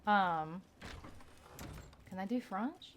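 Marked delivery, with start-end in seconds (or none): 2.1-2.9 French-accented "Fronch", voice lifting into a question; tentative go at a French accent; attempted French accent. Shown as a demonstration. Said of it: "that's not French".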